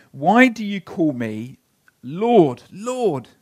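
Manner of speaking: forceful Jesus quote. Acted. Jesus challenging the crowd, loud and pleading. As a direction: press the question hard.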